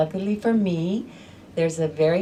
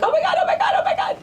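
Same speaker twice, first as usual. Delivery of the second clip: much higher, loud panicked squeal; panicked cries; 7 dB louder, much faster than usual. Retold or imitated. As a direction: shriek it in a panic. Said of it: "and it's like".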